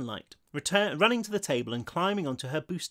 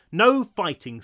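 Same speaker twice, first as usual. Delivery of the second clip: louder, higher firm order; scolding; 4 semitones higher, 7 dB louder, slower than usual. A narrator's reading. Arlene stopping two ghosts fighting, scolding. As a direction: lay down the law to squabblers.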